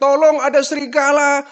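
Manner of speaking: loud high desperate cry; panicked cry for help. Retold or imitated. Boy terrified as wolves eat his goats, panicked. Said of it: "dia teriak-teriak".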